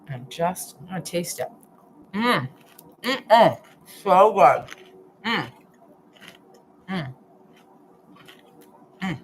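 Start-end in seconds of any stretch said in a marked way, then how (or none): 2.1-3.6 appreciative mm sounds with a mouthful; savoring mm-mm with food in mouth; tasting hums. Real, not acted. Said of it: "I shouldn't talk with my mouth full". 4.0-4.6 mouth full. Real, not acted. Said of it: "I shouldn't talk with my mouth full". 5.3-9.2 appreciative mm sounds with a mouthful; savoring mm-mm with food in mouth; tasting hums. Real, not acted. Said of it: "I shouldn't talk with my mouth full".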